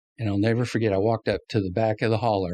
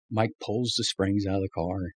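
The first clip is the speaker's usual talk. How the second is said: ordinary talk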